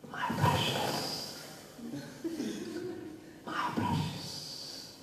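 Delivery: hissed, whispered Gollum voice; Gollum impression. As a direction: rasp it out like the creature clutching the ring. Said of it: "hearing the ugly voice".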